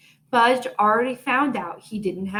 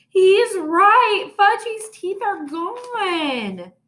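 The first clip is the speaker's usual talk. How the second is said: high, loud, slow cry of alarm; dismayed wail; 10 semitones higher, 5 dB louder, much slower than usual. A narrator's reading. Sheila seeing Fudge's teeth are gone, dismayed wail. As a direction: wail it in shock.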